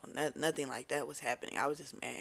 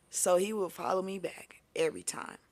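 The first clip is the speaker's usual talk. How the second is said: normal talking voice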